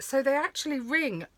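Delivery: normal talking voice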